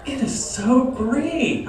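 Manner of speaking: high, slow gushing mom; a high, drawn-out mock mom. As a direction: gush like a delighted mother. Mom voiced in a stand-up bit.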